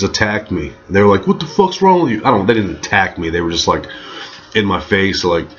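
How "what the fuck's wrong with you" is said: high pitched confronting yell; angry yell. Retold or imitated. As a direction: shout it up in his face. Angry dudes confront him.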